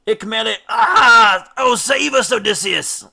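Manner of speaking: cut-off name then a piercing high scream; terrified scream. A narrator's reading. Rower snatched by Scylla begging Odysseus, screaming. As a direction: shriek for help as you're snatched.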